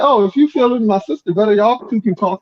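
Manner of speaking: high mock female voice; imitating the sister. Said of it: "she's like".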